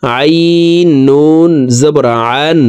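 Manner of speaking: very slow spell-out, "আইন" squeezed from mid-throat; pressed throat ayn. Shown as a demonstration. Teacher demonstrating ayn to viewers, pressed throat sound. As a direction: press the throat on "আন" and hold it long. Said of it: "হলকের মধ্যখান থেকে চাপ দিয়ে উচ্চারণ করতে হবে".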